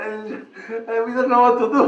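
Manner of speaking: high, loud voice breaking into laughter; laughing retelling. Retold or imitated. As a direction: quote their helplessness through laughter. Laughs retelling the ladies' helplessness.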